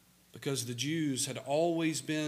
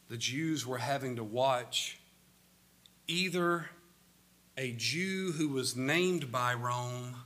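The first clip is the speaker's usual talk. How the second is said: normal talking voice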